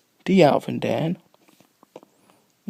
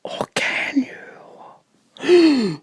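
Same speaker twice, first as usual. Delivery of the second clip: high, loud and slow teasing question; mock ominous voice; mock spooky tease; 12 semitones higher, 5 dB louder, much slower than usual. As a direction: spook them playfully.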